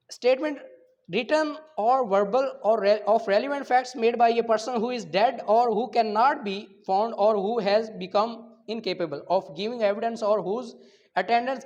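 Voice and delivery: loud, slow dictation of legal text; slow statute reading. Acted. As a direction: spell out each clause for students to copy. Reads the statute clauses aloud.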